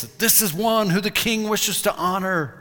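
high, loud public announcement; a herald calling to the crowd; herald's proclamation. Retold or imitated. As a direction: cry it out like a royal herald. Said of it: "yell out in loud voice".

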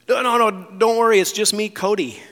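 high, loud, hurried hands-up pleading; a startled man throwing his hands up fast; panicked plea. Retold or imitated. Intruder begging homeowner not to swing, panicked high plea.

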